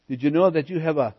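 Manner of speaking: normal talking voice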